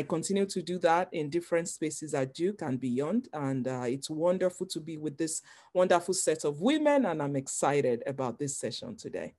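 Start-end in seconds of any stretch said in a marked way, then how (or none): none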